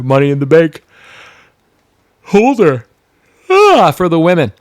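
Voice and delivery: rising to a loud, high call; hype announcer. Acted. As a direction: call out the winner like a ring announcer. Hyping the winner.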